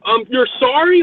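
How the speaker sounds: high, loud mock disbelief; incredulous anger. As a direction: throw his apology back at him in disbelief. Retold angry reply to driver.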